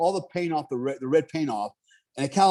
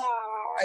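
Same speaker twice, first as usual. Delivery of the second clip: high, drawn-out hysterical "ah"; mock panicked wail; 7 semitones higher, 6 dB quieter than usual. Husband mimicking his wife after the deer crash, high mock wail. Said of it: "like, lost her shit".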